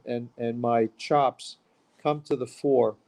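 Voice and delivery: usual speaking voice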